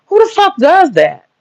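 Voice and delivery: real incredulous outrage; exasperated outburst. Real, not acted. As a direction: throw up your hands in disbelief. Exasperated rant about scientists.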